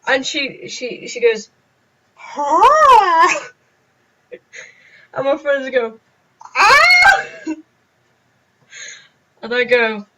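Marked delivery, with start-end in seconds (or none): 2.1-3.5 high, loud startled huh; shocked huh. Retold or imitated. Teacher reacting to the cheeky excuse, a shocked huh. 6.5-7.3 high, loud shrieking ah; friends' shocked shriek. Retold or imitated. Friends hearing the comeback, a loud shriek.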